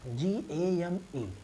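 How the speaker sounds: very slow, separated letters; spelling out. Shown as a demonstration. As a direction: spell it out slowly for learners. Spelling out the example word. (एक-एक अक्षर धीरे-धीरे बोलते हुए)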